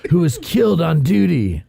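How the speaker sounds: hammed-up seventies show intro; dramatic TV narrator. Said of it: "sounds like a seventies detective show".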